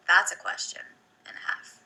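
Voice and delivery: hushed, under-the-breath remark; musing on a tough question in a whisper; whispered aside. Real, not acted. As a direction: whisper it to yourself. Aside about a hard question.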